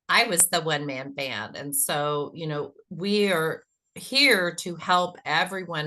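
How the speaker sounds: ordinary delivery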